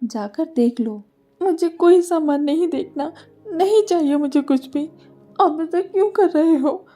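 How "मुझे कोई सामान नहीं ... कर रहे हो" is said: put-on young wife's higher voice, tearfully pleading; crying. A narrator's reading. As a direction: plead through tears, lost and scared. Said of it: "वो रोए जा रही थी।". (रोते हुए गिड़गिड़ाना)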